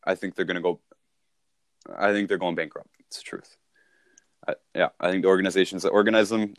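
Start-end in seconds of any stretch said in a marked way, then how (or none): 3.0-3.6 soft whispered confession; whispered aside. Real, not acted. Speaker confiding to Chris, a soft whisper. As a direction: whisper it like a secret.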